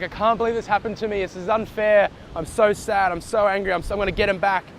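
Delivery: mock a wallowing victim; hammed-up aggrieved moaning; self-pitying victim. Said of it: "be an effect and be like".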